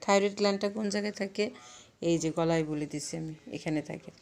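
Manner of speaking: usual speaking voice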